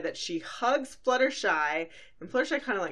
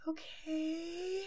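timid Fluttershy voice; 11 semitones higher, 8 dB quieter than usual. Retold or imitated. Fluttershy answering Luna's requests.

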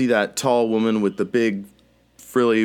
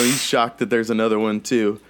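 ordinary talk, nothing marked

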